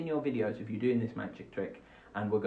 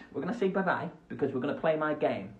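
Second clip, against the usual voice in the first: ordinary delivery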